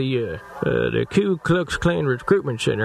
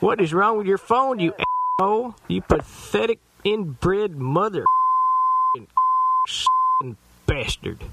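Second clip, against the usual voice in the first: angry yelling; 6 semitones higher, slower than usual. Acted. Caller yelling insults at owner.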